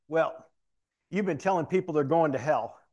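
imitated boss's loud charge; acted accusation. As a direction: accuse him loudly, as an irate boss. Captain accuses him, acted out.

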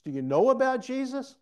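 KNOW ABOUT pushed high and loud; high, loud contrast. Real, not acted. Preacher contrasts knowing with belonging, high and loud. Contrasted, dismissed question to congregation.

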